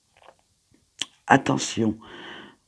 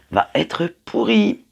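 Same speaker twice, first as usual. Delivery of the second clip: mock despair; 11 semitones higher, 4 dB louder, slower than usual. Real, not acted. Announces rotten weekend.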